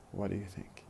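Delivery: "What do you think?" linked, very weak do; fast connected speech. Shown as a demonstration. Teacher pronounces examples for students, in fast connected speech. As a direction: run "What do you think?" together, do and you unstressed. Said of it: "and then in fast speech".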